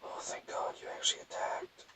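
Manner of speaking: whispered aside to yourself; relieved whisper. Real, not acted. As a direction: breathe it out in a hushed whisper. Muttering relief to himself in game.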